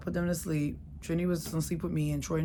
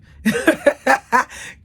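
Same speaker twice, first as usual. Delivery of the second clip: loud laugh; 9 semitones higher, 11 dB louder than usual. Real, not acted. Laughs at junk furniture.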